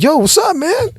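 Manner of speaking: high, laughing mock whine; whiny complaint to God. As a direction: squeak out a hammed-up complaint to the Lord. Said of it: "I'm like".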